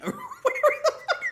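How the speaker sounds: a squeaky high burst of laughter; high-pitched laugh. Real, not acted. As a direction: laugh at your own bad French. Laughs at own French.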